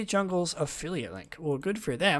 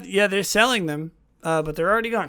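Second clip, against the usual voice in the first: ordinary delivery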